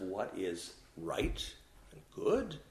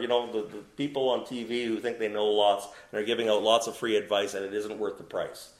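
ordinary talk, nothing marked

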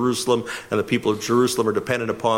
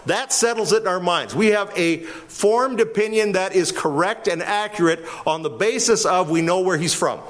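ordinary delivery

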